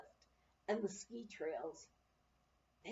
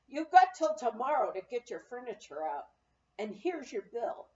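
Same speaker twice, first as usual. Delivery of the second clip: loud, curt brush-off; gruff clerk; 8 dB louder than usual. A narrator's reading. Sour-faced clerk bluntly dismissing the lady, loud and curt. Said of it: "a sour-faced fellow looked ... and said".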